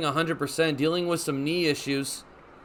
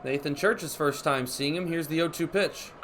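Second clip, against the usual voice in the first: ordinary delivery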